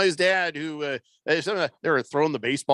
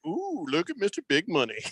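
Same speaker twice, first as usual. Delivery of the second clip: mock awe; 3 dB quieter than usual. Co-host teasing a friend about his ten-dollar bet, mock awe. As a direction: act mildly impressed, poking fun.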